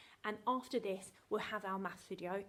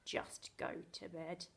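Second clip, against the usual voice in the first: slow, low, softer mock order; drawn-out, lowered pretend exasperation; imitating weary mum; 4 semitones lower, 5 dB quieter, slower than usual. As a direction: say it like a worn-out mum. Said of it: "And she says".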